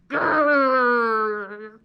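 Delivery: long rumbling grrr; playful drawn-out bear growl. Pretend. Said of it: "growl like a bear".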